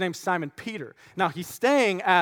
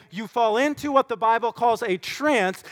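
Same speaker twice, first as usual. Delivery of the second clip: normal talking voice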